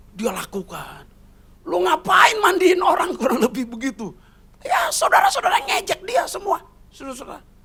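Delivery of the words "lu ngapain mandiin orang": high, loud jeering question; mocking shout. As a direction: ridicule what she is doing. Relatives mock her.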